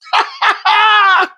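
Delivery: high tearful laugh-cry; overjoyed sobbing laugh; laughing sob. Retold or imitated. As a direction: imitate a shouted laugh breaking into crying. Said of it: "She called me crying".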